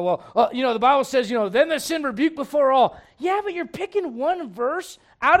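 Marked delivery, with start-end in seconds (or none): 0.0-3.0 mock self-righteous preaching. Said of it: "some people go".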